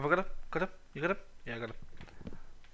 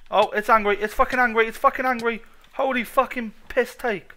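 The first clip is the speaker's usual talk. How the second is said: loud, higher, panicked yelling; alarmed shout; 7 semitones higher, 9 dB louder than usual. Real, not acted. Gamer yells in panic as the Naga boss attacks. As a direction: shout as the boss comes for you.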